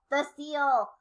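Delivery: ordinary delivery, nothing marked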